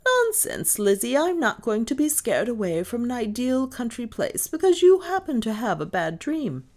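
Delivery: high, emphatic dismissal; firm scolding. A narrator's reading. Mistress refusing to be scared away, firm and indignant. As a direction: put the foolishness down firmly.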